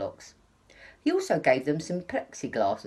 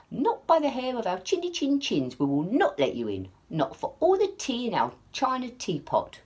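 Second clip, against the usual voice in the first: high, defiant wolf-cub voice; little wolves refusing; 8 semitones higher than usual. A narrator's reading. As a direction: stand firm in a high squeak. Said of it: "said the little wolves".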